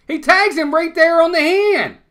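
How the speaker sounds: high, loud, exasperated shout at the replay; outraged yell. Real, not acted. Fan shouting at the TV replay, high and loud in disbelief. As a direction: yell it like the call is robbing you.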